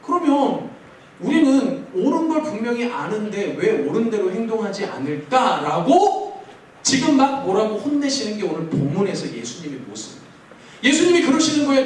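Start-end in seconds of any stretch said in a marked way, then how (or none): none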